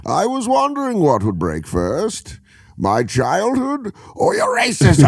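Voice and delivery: parody Bane threat. Acted. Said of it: "that whole speech".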